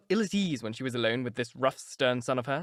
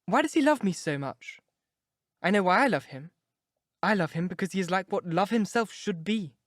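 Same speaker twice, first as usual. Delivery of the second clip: put-on a higher, eager young girl; a lovestruck girl pouring her heart out; young girl in love; 5 semitones higher than usual. A narrator's reading. Sybil voiced by narrator.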